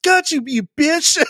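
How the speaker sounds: mock trash talk. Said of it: "It's more like".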